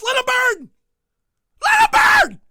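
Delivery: very high, loud screamed rant; furious shout. Acted. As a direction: scream it with mock fury.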